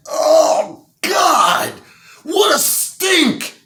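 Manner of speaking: high, loud, slow anguished wail; high disgusted cry. Acted. Client wailing at the fart's stink. Man gagging at the fart.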